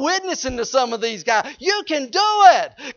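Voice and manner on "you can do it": urging shout. Real, not acted. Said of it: "urging the congregation".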